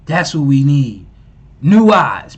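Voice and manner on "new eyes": loud, punchy call of new eyes; emphatic shout. Real, not acted. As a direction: hit new hard, falling. Echoing the clip, hyped.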